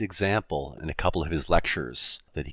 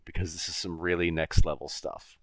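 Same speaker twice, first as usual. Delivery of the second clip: ordinary talk, nothing marked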